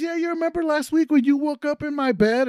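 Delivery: high, put-on party host voice; imitating birthday boy. Birthday boy reminding Chris of last week, high mock voice. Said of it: "He goes".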